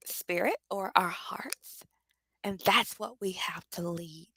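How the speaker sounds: hushed, breaking whisper on the heart of it; emotional whisper. Real, not acted. Leader moved while teaching, whispering. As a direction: let it drop to a whisper as emotion rises.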